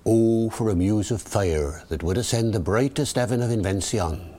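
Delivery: original pronunciation, rhotic Elizabethan accent; rolled-back Early Modern English accent; Shakespearean original pronunciation. Shown as a demonstration. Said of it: "David the O P".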